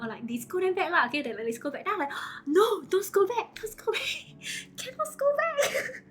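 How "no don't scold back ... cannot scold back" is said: high, slow, pleading with a nervous laugh; panicked pleading. Retold or imitated. Streamer begging her viewers not to scold back, high panicked plea. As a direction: beg them to stop, half laughing in panic. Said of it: "I was very scared".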